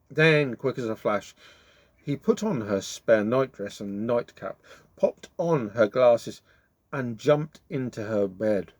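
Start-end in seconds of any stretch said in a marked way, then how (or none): none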